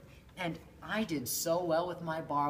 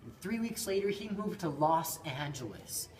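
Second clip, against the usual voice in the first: ordinary delivery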